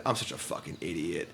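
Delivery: soft, low, defeated self-scold; muttered self-blame. Real, not acted. Streamer berating himself after the punt, muted and low. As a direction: drop the voice, beaten. Realizing he lost the game.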